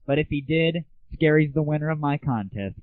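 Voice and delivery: usual speaking voice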